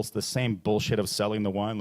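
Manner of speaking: ordinary delivery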